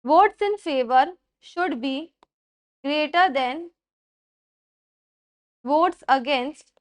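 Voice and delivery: word by word, very slow, for students to write; spaced-out dictation pace. Shown as a demonstration. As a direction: dictate each phrase slowly with pauses. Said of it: "slow dictation of the rule".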